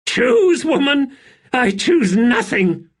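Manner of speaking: very high, loud, near-hysterical cry. A narrator's reading. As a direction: scream it on the edge of madness. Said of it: "A laugh close to hysteria".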